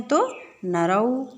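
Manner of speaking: drawn-out नरौ, each sound clear; slow model answer. Shown as a demonstration. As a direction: stretch नरौ slowly for the class. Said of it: "और दो हैं".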